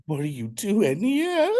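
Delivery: high, slow, starstruck disbelief; surprised. Retold or imitated. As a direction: squeal it out in shock. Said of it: "and I was like".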